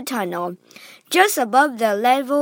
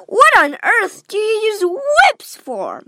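indignant; 9 semitones higher, 5 dB louder than usual. Acted. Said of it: "cried Veruca Salt".

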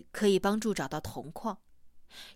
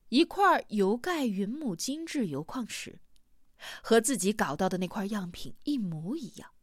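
ordinary delivery

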